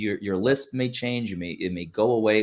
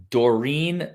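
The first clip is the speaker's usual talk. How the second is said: usual speaking voice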